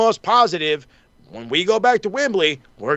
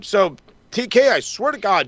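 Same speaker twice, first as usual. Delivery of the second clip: normal talking voice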